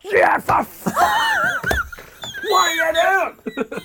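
high, loud exasperated yell; angry dad shout. Retold or imitated. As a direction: imitate a father blowing up at his son. Acting out his angry father.

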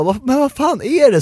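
panicked mother demanding answers; alarmed mom. Retold or imitated. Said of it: "Och så mamma bara".

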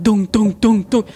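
loud, rhythmic thuds; imitated machine thudding. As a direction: pound out the words like a feed machine. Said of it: "fodermaskinen som går och dunkar". (härmat dunkande från fodermaskinen)

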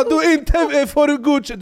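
hög, högljudd sträng förälder; skäll som en besviken förälder; scolding parent. Retold or imitated. Härmar stränga persiska föräldrar. (föräldrar skäller på laget, högt och ljust)

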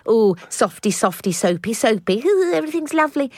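high, twee mock-lovely voice; sugary send-up; mock-soppy gushing. Mocking soft, lovely radio mood.

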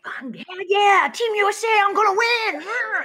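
cartoonish American bravado; mock American cheer. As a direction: play an overeager American fan shouting Team USA. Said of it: "They're like".

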